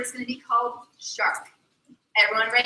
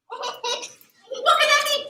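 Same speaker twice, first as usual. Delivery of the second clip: ordinary delivery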